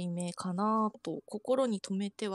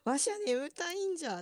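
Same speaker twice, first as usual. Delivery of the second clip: lightly voiced sleepy grandpa; a hint of a drowsy old man; creaky wise old man; 7 semitones higher than usual. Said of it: "って言ってんの".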